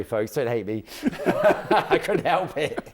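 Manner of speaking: ordinary delivery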